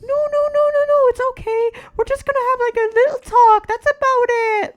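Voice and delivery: very high, sugary falsetto teacher; mock sweet teacher. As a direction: parody a fake-nice teacher soothing bullies. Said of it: "They'd be like".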